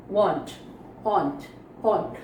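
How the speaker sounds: वांट with a rounded ओ-like vowel, not आ; careful vowel in want. Shown as a demonstration. (वांट को ओ जैसी ध्वनि के साथ बोलिए)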